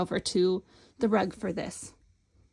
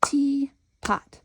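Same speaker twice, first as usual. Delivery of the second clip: teapot split into beats; clapping out the syllables; 4 semitones higher than usual. Shown as a demonstration. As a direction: count the claps as you say it. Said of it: "Let's count".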